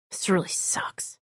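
hushed whisper of dread; quiet, miserable whisper. Acted. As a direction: mutter it under your breath. Dreading a forced execution.

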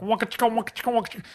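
high-pitched wah-wah guitar riff imitation; imitated funk guitar. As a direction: vocalize a funky wah-wah theme. Imitating Shaft theme music.

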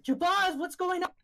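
high, urgent cry through a door; alarmed calling. Acted. Trapped woman shaking the door, high alarmed call. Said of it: "shaking the door".

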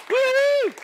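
high, loud cheer; excited woo-hoo. Real, not acted. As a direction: whoop for the people coming forward. Cheering people coming forward.

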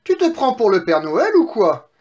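high, loud piglet jeer; mocking exclamation. Three pigs mock wolf's chimney plan, loud exclamation. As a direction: jeer at him in disbelief. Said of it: "s'exclamèrent les trois petits cochons".

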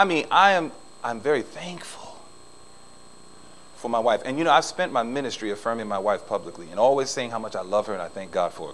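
normal talking voice

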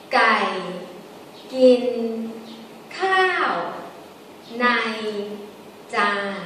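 slow tone-by-tone demo with a falling khao; Thai sentence tones. Shown as a demonstration. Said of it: "now I move my hand too".

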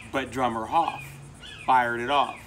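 ordinary delivery

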